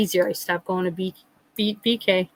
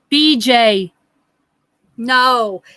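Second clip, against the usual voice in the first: ordinary talk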